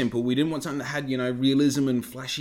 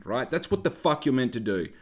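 ordinary delivery

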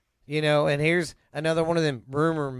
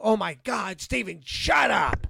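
higher-pitched imitation of a fed-up dad, slowing on the telling-off; mock exasperated dad; 4 semitones higher, slower than usual. Son repeating what his dad said, mock exasperation. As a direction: lightly imitate a dad who has had enough. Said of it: "Making him go".

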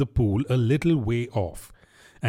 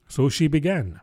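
ordinary delivery

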